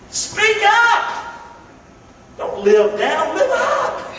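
high, laughing call to live up; laughing exhortation. Acted. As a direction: lift them up with a laugh.

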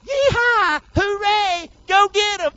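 high mock cheering crowd; cheerleader whoop. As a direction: whoop it up like pom-pom girls. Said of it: "for somebody to go".

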